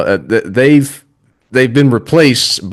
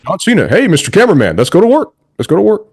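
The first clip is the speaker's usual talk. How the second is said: high-pitched mocking wrestler impersonation; John Cena; 9 semitones higher, much faster than usual. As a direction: parody his camera catchphrase delivery. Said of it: "how he sounds when he talks".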